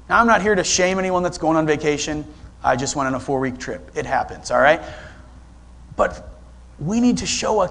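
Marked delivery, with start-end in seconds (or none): none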